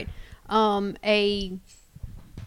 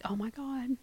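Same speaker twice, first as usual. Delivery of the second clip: breathy shocked whisper of "Oh, my God."; whispered disbelief; 2 semitones higher, 13 dB quieter than usual. Retold or imitated. Recalling the flamingo news, hushed "Oh, my God". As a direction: drop to a stunned whisper.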